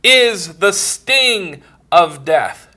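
loud, high fervent sermon voice; impassioned pulpit delivery; full-force preaching. Acted. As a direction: preach it out, swelling with conviction. Preaching grace and death.

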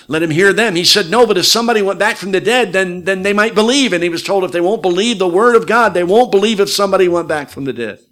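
high, loud pulpit delivery, driving hard; full-force preaching. Retold or imitated. Preacher retelling the rich man's plea and answer, full-force preaching. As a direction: thunder out the retold argument without letting up.

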